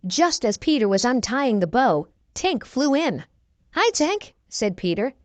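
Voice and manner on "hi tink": high-pitched, bright hello; light storybook boy's greeting; cheery greeting. A narrator's reading. Peter greets Tink cheerily.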